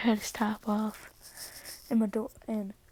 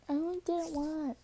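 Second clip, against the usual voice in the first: put-on girl voice; 6 semitones higher than usual. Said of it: "She's like".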